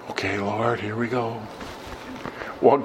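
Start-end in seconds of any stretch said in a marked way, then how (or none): 0.1-1.4 softly brace yourself, under your breath; hushed, retold word to the Lord; quiet aside prayer. Retold murmured aside to God.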